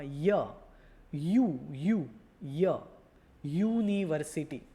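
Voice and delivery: ય and યુ said singly and firmly, then યુનિવર્સિટી; યુનિવર્સિટી led in by its separated first sound યુ; first sound isolated. Shown as a demonstration. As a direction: punch out the opening ય, યુ before saying યુનિવર્સિટી. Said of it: "બોલીએ છીએ કેવી રીતે ગુજરાતીમાં".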